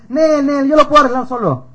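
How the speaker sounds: high, loud mock brush-off; cocky refusal. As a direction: imitate a defendant waving off help. Imita al acusado que rechaza ayuda. (rechazo altanero, agudo y fuerte)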